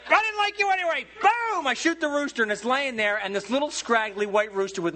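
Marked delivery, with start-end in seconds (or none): 0.1-1.0 high, mocking jab at the rooster; mock taunt. Husband taunts the rooster with a high, mocking jab. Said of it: "I'm like". 1.2-1.7 gunshot boom. Retold or imitated. Imitating the gunshot.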